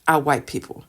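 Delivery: ordinary delivery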